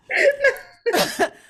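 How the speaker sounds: loud high-pitched cackle; high laugh. Real, not acted. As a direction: burst into a shrieking laugh. Laughing at own story.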